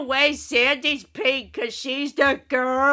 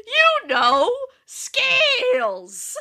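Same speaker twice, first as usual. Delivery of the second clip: higher, hammed-up pompous exclamation; mock pompous echo; 12 semitones higher, much slower than usual. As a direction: exaggerate the snobby name-drop, pitched up. Echoing the pompous Scales quote.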